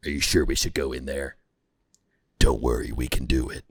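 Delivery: low, loud, hammed-up action-hero voice; mock macho movie dudes. Reviewer parodying Sicario's script, low loud macho dude voice. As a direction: boom it out like a square-jawed soldier.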